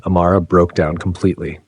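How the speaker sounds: ordinary delivery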